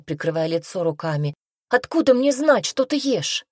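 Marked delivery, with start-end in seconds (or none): 1.7-3.4 high-pitched, alarmed protest; raised girlish voice, pushed out defensively; exclaiming. A narrator's reading. Frightened Glenna answers the witch, exclaiming. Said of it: "воскликнула Гленна".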